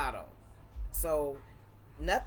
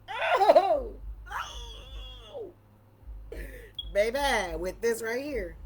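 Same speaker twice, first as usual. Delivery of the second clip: delighted squeal; 11 semitones higher, 8 dB louder, much slower than usual. Real, not acted. Savoring the cigar for viewers, high delighted swoon.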